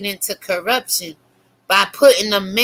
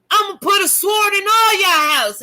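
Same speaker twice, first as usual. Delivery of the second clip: high shouted threat as a wrathful God; God's voice; 9 semitones higher than usual. Acted. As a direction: roar it like an angry God. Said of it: "God said".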